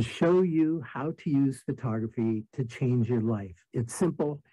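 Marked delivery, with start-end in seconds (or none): none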